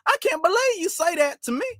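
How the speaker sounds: very high, Looney Tunes Southern; exaggerated cartoon Southern imitation; cartoonish fake Southern accent. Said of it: "they won't say you. They say".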